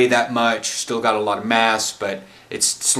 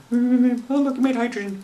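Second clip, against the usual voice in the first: mocking voice; 12 semitones higher than usual. Mocking quote of naive viewer.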